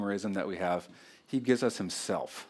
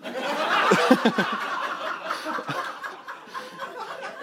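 real laugh, high and airy; high breathless laugh. Real, not acted. Preacher cracking up at his daughter's line. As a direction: let the laugh squeak out high.

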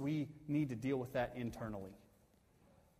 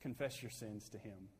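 ordinary delivery

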